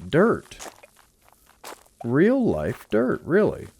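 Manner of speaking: delighted high exclamation; excited delight. Real, not acted. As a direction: gush over it like treasure. Surprised delight at finding dirt.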